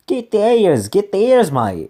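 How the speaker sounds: hammed-up, badly faked Australian; terrible Australian. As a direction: do a clumsy, high Australian accent. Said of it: "this terrible Australian".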